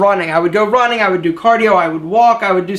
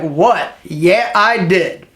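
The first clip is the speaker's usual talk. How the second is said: lower, slow, smug "Yeah, I did"; cocky brag; 4 semitones lower, much slower than usual. Retold or imitated. The speaker after a great talk, bragging smugly. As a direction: play it cool and full of yourself. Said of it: "you're gonna be like".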